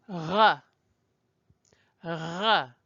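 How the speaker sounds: "R" as a throaty French ruh. Shown as a demonstration. Teacher demonstrates the sound the French make, throaty "R". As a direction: scrape "R" at the back of the throat. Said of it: "the sound the French make".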